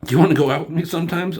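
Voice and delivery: rushed ask. Retold or imitated. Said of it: "Scott Pilgrim".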